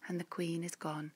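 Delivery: ordinary delivery, nothing marked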